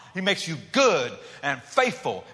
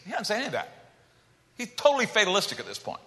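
ordinary talk

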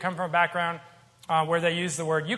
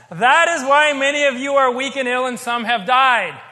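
loud, high, forceful proclamation; emphatic scripture reading; 6 semitones higher, 9 dB louder than usual. Real, not acted. Preacher reading the worst verse to a congregation, loud and emphatic. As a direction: drive the warning home at high volume, slowing at the end.

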